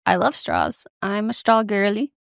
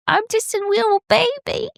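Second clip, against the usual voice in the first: put-on much higher, squeaky "wittle baby" talk; 13 semitones higher than usual. Demonstrating the baby voice for the dilemma, in high baby talk. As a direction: squeak it like a tiny toddler. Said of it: "a baby voice".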